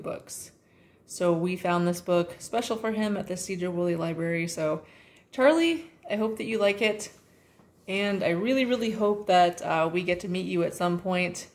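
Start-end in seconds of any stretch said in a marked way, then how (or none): 5.3-5.9 calling out. Real, not acted. Parent calls baby Charlie's name through the camera, excited. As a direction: call his name warmly to get his attention.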